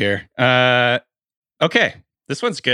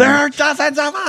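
squeaky joking cry, much higher; high mock squeal; 4 dB louder than usual. Host squeals a joke quote at cohosts. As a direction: squeal it like a running gag.

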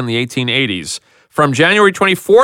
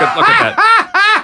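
laughing; slower than usual. Real, not acted. Amazed by a random page.

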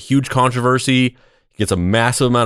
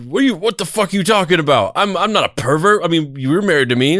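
indignant Gerald; 5 semitones higher, faster than usual. Retold or imitated. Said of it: "He's like".

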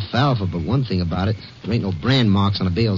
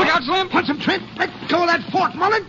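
frantic shouting. Acted. Slim yelling to Trent and Mullen during the fight, frantic shouting. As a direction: shout for help mid-fight, all-out.

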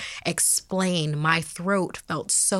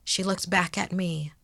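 ordinary delivery

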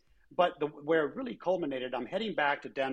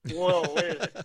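loud, high mock alarm; a startled investor pulling up short; 5 semitones higher, 6 dB louder than usual. Voicing worried investors hearing the plan, mock alarm. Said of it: "'Cause they're like".

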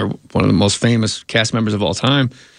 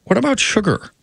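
mock Jim Brooks; 11 semitones higher than usual. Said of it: "Jim Brooks goes".

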